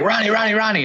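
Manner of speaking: eager rookie calling repeatedly for a pass; yelling his name. Retold or imitated. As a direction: shout the teammate's name to get the puck. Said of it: "Stop yelling my name".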